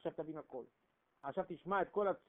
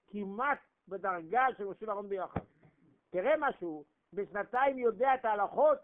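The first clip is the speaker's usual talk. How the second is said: louder, higher fervent sermon climax; preaching at full force; 3 semitones higher, 6 dB louder than usual. Acted. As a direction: build the crowd up with each line.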